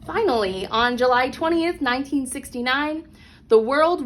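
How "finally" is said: ordinary delivery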